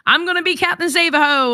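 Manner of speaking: normal talking voice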